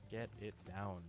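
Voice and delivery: usual speaking voice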